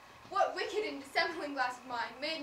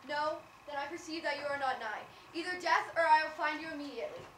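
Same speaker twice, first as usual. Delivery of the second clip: ordinary delivery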